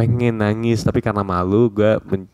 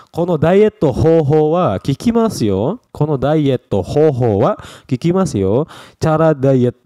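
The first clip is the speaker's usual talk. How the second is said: higher, slower, a touch louder ad pitch; woman's sales pitch; 7 semitones higher, 4 dB louder, slower than usual. Acted. Teacher playing a woman praising a diet. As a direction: sell the diet like a cheery woman. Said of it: "Ini harusnya perempuan yang ngomongnya".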